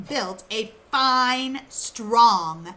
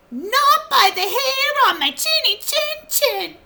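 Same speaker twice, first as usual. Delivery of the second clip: high pig voice; 6 dB louder than usual. Acted. Pig answers wolf.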